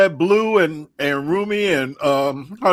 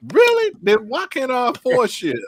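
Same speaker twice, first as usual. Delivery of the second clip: incredulous; 7 semitones higher than usual. Retold or imitated. Mocking the tariff claim.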